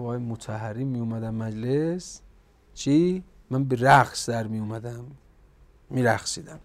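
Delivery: normal talking voice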